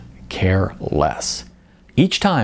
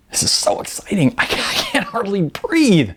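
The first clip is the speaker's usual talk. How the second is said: breathless excitement; 11 semitones higher than usual. Acted. Melanie overwhelmed with excitement.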